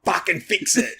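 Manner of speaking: high-pitched imagined command; hammed-up, impatient demand; mock blunt order. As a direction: imitate an exasperated boss's order. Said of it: "What I heard was".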